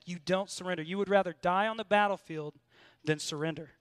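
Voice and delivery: ordinary delivery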